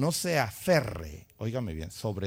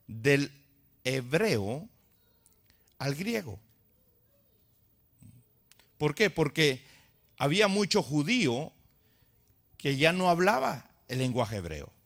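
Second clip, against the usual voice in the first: normal talking voice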